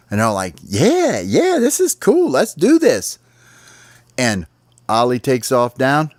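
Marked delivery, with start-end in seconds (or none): 0.6-3.2 very high excited chirpy voices; cheering sparrows. Acted. Sparrows cheer Ollie on, high and excited. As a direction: squeal with excitement like a flock.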